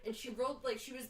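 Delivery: normal talking voice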